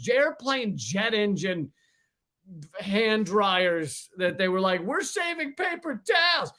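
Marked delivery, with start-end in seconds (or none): 4.8-6.5 high mock corporate voice; a sneering, much higher sales pitch; mocking the makers. Parodying dryer makers boasting to the public, high mocking voice.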